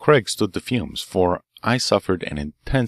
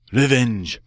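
a first cry of revenge, held back; 4 semitones higher than usual. Acted. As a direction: start the vow firmly. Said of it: "Poisson cried".

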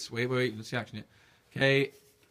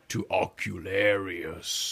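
dropped very low; 4 semitones lower, much slower than usual. Shown as a demonstration. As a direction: sink it down low and spooky. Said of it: "drop it down again to it's really low".

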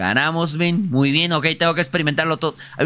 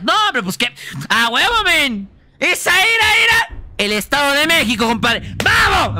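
excited yelling; 10 semitones higher than usual. Real, not acted. Cheering at a game win.

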